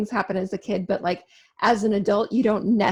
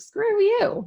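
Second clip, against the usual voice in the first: much higher, defiant snap; mock defiant kid; 12 semitones higher than usual. Kid defying parents who bark orders, high and snippy. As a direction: sass back at the parents. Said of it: "being like".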